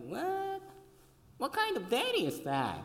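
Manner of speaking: high incredulous squeak; shocked disbelief. Retold or imitated. Young man reacts to a TV dad, high and incredulous. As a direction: jump up in disbelief. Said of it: "And I was like".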